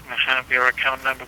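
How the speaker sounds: ordinary talk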